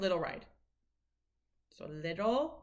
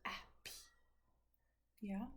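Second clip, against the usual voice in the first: normal talking voice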